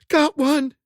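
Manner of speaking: high, loud relieved cry; breathless exclaim. A narrator's reading. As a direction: shout back in relief. Corinne answering in panic.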